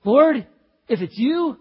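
loud, high call across the water; Peter calling out. Acted. Peter calling to Jesus over the storm, loud and high. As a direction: shout out to the Lord from the boat. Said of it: "He says".

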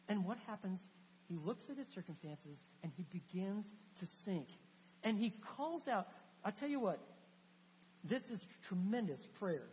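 ordinary delivery

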